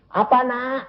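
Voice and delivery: high-pitched mild mock yell; retold calling out. Father to his sick daughter, retold high call. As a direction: call to the child high, without real shouting. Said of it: "saya teriak-teriak".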